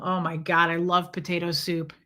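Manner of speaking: ordinary talk, nothing marked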